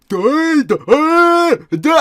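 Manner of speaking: very high, loud, droning duh; mentally ill stranger. Retold or imitated. Imitating the strange man in the woods to a friend, high loud drone. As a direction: mimic a stranger's wordless drone. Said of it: "he was like".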